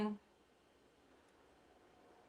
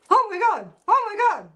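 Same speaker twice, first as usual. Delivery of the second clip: higher, louder mock panic gasping oh my God; mock alarm; 10 semitones higher, 6 dB louder than usual. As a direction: play the shocked crowd, hammed up. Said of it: "to get you to go".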